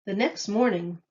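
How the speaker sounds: usual speaking voice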